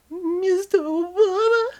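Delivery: squealing falsetto, not whispered; breathy high cry; high lover voice. Acted. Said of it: "they whisper".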